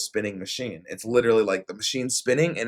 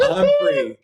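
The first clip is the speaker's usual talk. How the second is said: squealed high and loud in giddy relief; ecstatic relief; 10 dB louder than usual. Retold or imitated. Telling friends, squealing with relief at being free. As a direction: shriek it like sudden freedom. Relief after losing finger.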